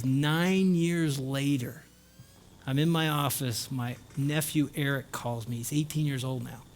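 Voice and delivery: soft, slightly subdued retelling; understated, softened voice for the story's ending; hushed storytelling. Retold or imitated. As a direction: draw them in quietly for the payoff. Storyteller quotes uncle's quiet ending.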